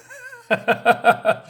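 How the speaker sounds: high, bright burst of laughter; amused laugh. Real, not acted. The reader laughs at the comic's dialogue, big laugh. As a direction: laugh out loud at the line. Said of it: "laugh at comic".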